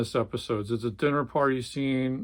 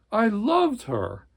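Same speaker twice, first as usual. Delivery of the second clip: much higher, slow plea; pleading; 9 semitones higher, slower than usual. Acted. Swede to Jerry about his wife, pleading. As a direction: say it like a desperate plea.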